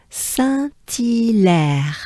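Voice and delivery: "Saint-Hilaire" very slow, san-tee-lèr; exaggerated French pronunciation. Shown as a demonstration. Teacher leading learners together, very slow san-tee-lèr. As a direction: draw out each syllable of "Saint-Hilaire", t linked. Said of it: "Let's do it together".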